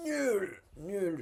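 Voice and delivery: high, loud comic verdict; exaggerated disdain. Real, not acted. Coder trashing an AI model to viewers, exaggerated disdain.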